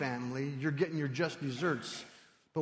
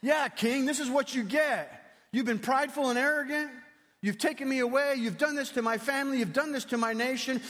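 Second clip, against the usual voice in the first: gloating taunt; 8 semitones higher, 5 dB louder than usual. Pretend. Imagined Daniel taunting the king.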